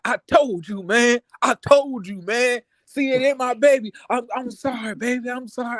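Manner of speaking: high, pleading mock apology; hammed-up excuse from a cheating man, pitched high; imitating Glenn. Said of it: "He up there like".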